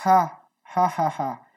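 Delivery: light h sound, as in English house; light glottal h. Shown as a demonstration. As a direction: breathe out a gentle throaty ha, ha. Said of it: "do fundo da garganta, bem levinha".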